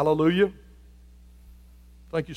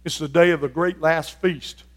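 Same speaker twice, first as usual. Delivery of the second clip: ordinary talk, nothing marked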